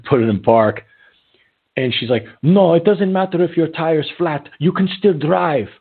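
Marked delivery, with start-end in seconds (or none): 2.4-5.7 high-pitched mock Brazilian accent, insistent; imitated Brazilian accent. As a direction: mimic a Brazilian passenger arguing, voice pitched way up. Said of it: "with her accent".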